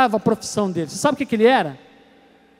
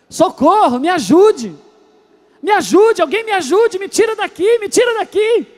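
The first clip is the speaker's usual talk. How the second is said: put-on higher, louder little-boy cries for help; child calling for help; 7 semitones higher, 3 dB louder than usual. Three-year-old son stuck by the bed railing shouting. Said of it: "ele começou a gritar".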